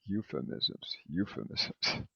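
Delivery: euphemisms murmured softly to a partner; quiet practice. Shown as a demonstration. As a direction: say euphemisms under your breath, twice. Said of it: "turn to your partner and say euphemisms twice".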